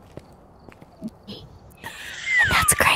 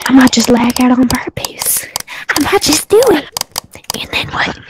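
creepy whisper; 5 dB louder than usual. Pretend. Teasing threats in creepy voice.

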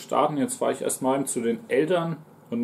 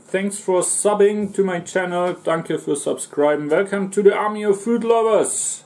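pumped-up higher channel greeting; excited welcome; 6 semitones higher, 4 dB louder than usual. Real, not acted. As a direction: hype up the new subscriber.

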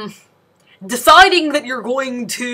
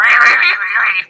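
Donald Duck voice; 3 dB louder than usual. Retold or imitated. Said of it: "his rah".

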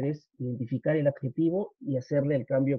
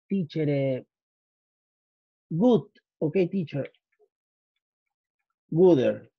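imitating a student. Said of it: "no vayan a cometer este error".

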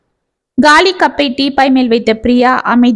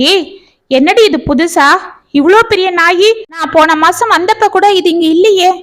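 raised voice, higher pitched call-out; surprised shout at her friend; 6 semitones higher than usual. A narrator's reading. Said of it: "என்று கத்தினாள்".

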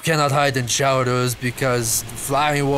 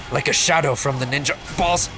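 normal talking voice

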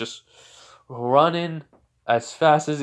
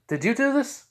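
high demanding question; 12 semitones higher than usual. Acted. Esdeath questioning the boy.

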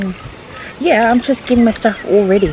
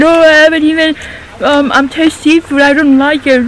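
loud, raised mocking impression; mocking imitation; 6 semitones higher, 6 dB louder than usual. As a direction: mimic him, making fun. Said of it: "He's lying".

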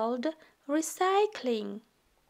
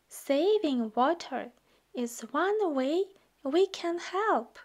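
ordinary delivery

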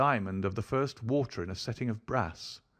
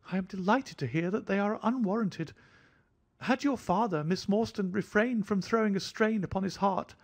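light, offhand, much higher thin voice; airy; 11 semitones higher than usual. A narrator's reading. Host airily apologizing to Miss Morstan in a high thin voice. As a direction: toss it off as if nothing mattered. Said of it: "he remarked airily".

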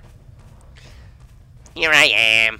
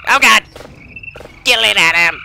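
loud, urgent cry of alarm; panicked yell; 6 semitones higher, 7 dB louder than usual. Real, not acted. Player begging Adam to kill it, panicked yell. Creature appears, urging partner.

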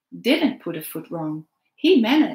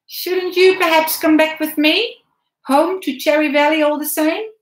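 higher, louder man's voice, probing; Hubert coaxing; 9 semitones higher, 8 dB louder than usual. A narrator's reading. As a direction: lure the boy home. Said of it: "he said".

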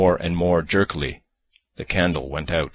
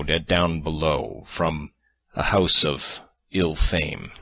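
slow halting words; reluctant, faltering delivery; hesitant, slow; slower than usual. Acted. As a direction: stumble over the shameful words. Hesitant euphemism.

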